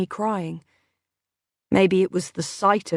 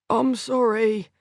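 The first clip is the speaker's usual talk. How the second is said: croaky with pain; 4 semitones higher, slower than usual. A narrator's reading. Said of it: "his voice still croaky with suppressed pain".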